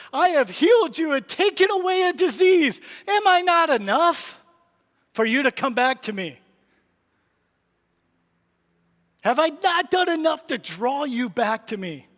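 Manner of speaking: pleading Jesus. Acted.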